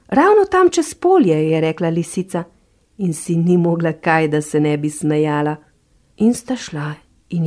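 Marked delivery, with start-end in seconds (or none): 0.1-1.5 high, slow sly fox voice. Acted. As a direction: point the way with hidden glee, high and slow. Fox sly, laughing inside.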